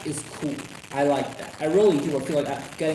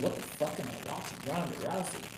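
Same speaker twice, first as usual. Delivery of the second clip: softer, stunned muttered question; quiet disbelief; 9 dB quieter than usual. Retold or imitated. Reliving watching Rousey appear, quiet stunned disbelief. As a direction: say it under your breath, stunned. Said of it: "I was like".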